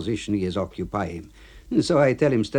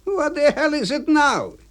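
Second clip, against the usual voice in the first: put-on much higher, louder gruff old friend; 4 dB louder than usual. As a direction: play the cemetery clerk high and loud. AB voiced in story.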